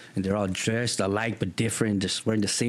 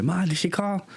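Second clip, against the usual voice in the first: put-on eager kid voice; 10 semitones higher than usual. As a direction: ask mom hopefully.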